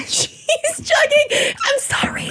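squealing through laughter, very high; words squeezed out by a laughing fit; helpless laughter. Real, not acted. Losing it mid-story to the friends, laughing hard.

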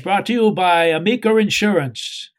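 Boston broadcaster voice, R dropped in Insurance; Boston sportscaster accent. Retold or imitated. Imitating the Red Sox announcer's Boston accent. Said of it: "he will talk about".

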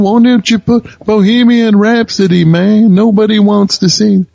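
high, loud mocking music-exec voice; Mike Myers impression. Mocking the exec who hated the six-minute song, high and loud. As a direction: ham up the pushy record exec, way up high. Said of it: "This Mike Myers in this Bohemian Rhapsody".

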